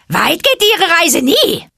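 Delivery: high, slow, loud mocking call. A narrator's reading. Vulture mocking the monkeys, high and slow. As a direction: sneer at the monkeys from above. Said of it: "lachte Chill spöttisch".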